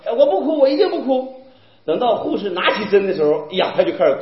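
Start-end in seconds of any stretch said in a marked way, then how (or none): 0.1-1.4 higher-pitched imitation of a determined child; child's brave vow. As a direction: play a kid insisting bravely. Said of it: "老大去的时候说". (模仿孩子逞强)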